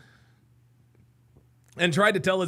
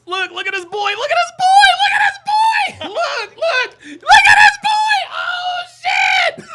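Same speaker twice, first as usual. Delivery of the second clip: much higher and louder, shrieking with laughter; laughing shout; 10 dB louder than usual. Real, not acted. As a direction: yell it out, cracking up at the clip. Bursting out laughing at clip.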